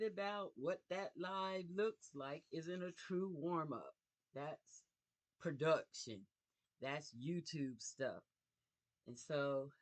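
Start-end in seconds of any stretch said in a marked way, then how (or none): none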